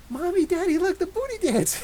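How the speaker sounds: put-on high giddy toddler calling parents; little kid voice. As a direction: play the proud four-year-old. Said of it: "he'll come out and be like".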